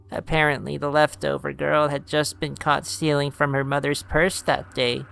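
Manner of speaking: lightly voiced old woman telling a tale; a hint of a storytelling grandmother; grandma voice. Grams' long story, held voice.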